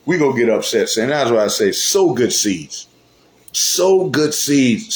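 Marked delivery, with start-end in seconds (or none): 1.7-2.9 drawn-out, SOW stressed; slow emphatic preaching. Real, not acted. Repeated exhortation. 3.5-4.9 Sow lifted higher and drawn out; slow, higher emphatic repeat. Real, not acted. Repeated exhortation.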